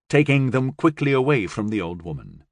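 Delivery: ordinary delivery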